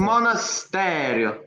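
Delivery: put-on Italian accent. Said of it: "using your Italian accent".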